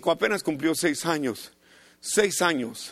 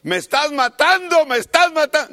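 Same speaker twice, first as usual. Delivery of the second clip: imitating a child's high yell, louder, under the belt; 9 semitones higher, 7 dB louder than usual. Child yelling at his father during a spanking, mock pained yell. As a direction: cry out like a kid getting spanked. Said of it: "Y el niño gritaba".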